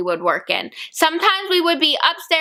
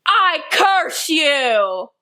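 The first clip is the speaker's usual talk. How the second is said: very slow, drawn-out mock curse; hammed-up curse; 2 semitones higher, much slower than usual. Classmate cursing the teller out of nowhere, theatrically drawn out. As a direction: stretch every word like casting a spell.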